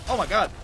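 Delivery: harsh cough then a gasp; coughing fit. Real, not acted. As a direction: cough hard, then groan it out. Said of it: "I got that right now".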